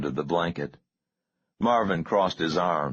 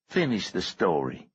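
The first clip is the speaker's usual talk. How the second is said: plain reading voice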